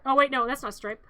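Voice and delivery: ordinary talk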